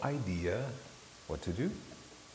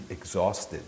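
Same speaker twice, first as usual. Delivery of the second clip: normal talking voice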